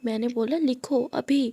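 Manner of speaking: plain reading voice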